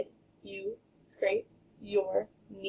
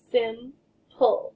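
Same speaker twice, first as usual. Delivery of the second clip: Sim-pul said as two separated beats, sim and pul; syllables chopped. Shown as a demonstration. Teacher modelling syllable chopping for kids, slow beats. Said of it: "do some syllables".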